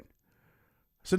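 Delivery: ordinary talk, nothing marked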